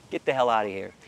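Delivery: ordinary talk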